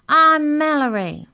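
high, drawn-out loud shout. A narrator's reading. Small Mallory introduces herself to giants, shouting. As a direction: shout the name up to someone far above. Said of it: "shouted Mallory".

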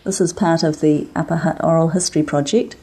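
measured, formal archive introduction; formal announcement. Acted. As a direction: read it like a formal record header. Reads the archive recording intro.

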